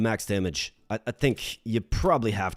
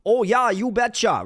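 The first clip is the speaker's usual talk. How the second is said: put-on Minnesotan accent; 10 semitones higher, 4 dB louder than usual. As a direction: lay the Minnesota accent on thick, long vowels. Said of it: "like a Minnesotan".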